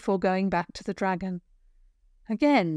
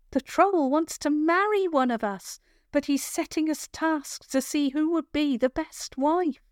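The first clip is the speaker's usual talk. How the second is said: put-on higher girl's voice, pressing and urgent; urgent young girl; 7 semitones higher than usual. Captive girl urging the rescuer to hide. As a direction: hurry him through the plan, insisting.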